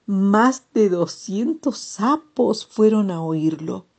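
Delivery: ordinary delivery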